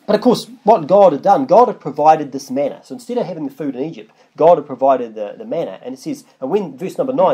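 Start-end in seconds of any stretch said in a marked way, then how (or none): none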